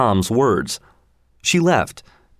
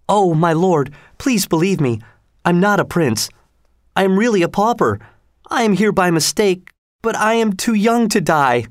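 put-on higher, fearful young voice; pleading boy; 8 semitones higher than usual. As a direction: beg the king to believe you. Tom pleads.

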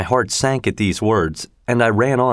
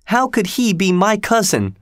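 put-on high-pitched, distressed girl; upset protest; 10 semitones higher than usual. A narrator's reading. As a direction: protest high, close to tears. Tearful girl protests about cousin.

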